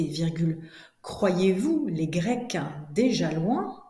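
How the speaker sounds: slow, carefully articulated chunks with long pauses; classroom dictation. Shown as a demonstration. Teacher dictates to pupils writing it down, slow deliberate dictation. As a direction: dictate phrase by phrase, punctuation spoken aloud.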